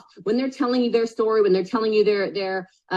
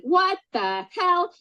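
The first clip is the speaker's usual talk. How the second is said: high, slow stunned exclamation; stretched-out shock at the price; shocked what the hell; 9 semitones higher, much slower than usual. Retold or imitated. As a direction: drag it out in disbelief. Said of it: "And you went".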